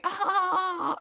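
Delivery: high drawn-out "aah"; high mock cry of alarm; mock scream. Teller seeing the reshaped aliens, high mock scream. Retold reaction to shapeshifted aliens.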